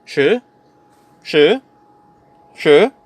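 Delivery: SHI rising quickly; "shi" in a rising second tone; Mandarin pronunciation. Shown as a demonstration. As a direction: lift "shi" like a question. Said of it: "number ten".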